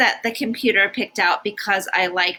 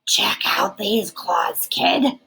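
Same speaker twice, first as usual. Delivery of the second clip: slow unvoiced monster rasp; raspy whisper; slower than usual. Third monster under the bed shows his claws in a slow rasping whisper. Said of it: "a third voice from under the bed rasped".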